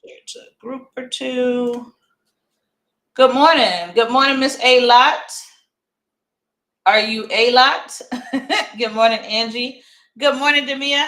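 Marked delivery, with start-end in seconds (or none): 3.1-11.1 loud greeting. Real, not acted. Loud greeting to viewers on live.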